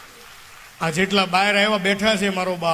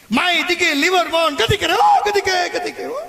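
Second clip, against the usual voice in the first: mimicking; 9 semitones higher, 3 dB louder than usual. Mimicking someone, laughing.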